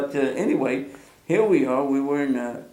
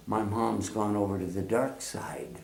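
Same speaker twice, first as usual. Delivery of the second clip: softer, breathy whispered line; mock whisper; 4 semitones lower, 8 dB quieter than usual. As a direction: whisper it like a dark secret. Imagined dramatic whisper.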